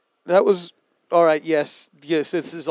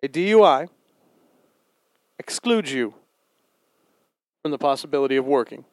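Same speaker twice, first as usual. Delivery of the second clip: staccato; faster than usual. Acted. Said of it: "can you say something without a staccato voice?".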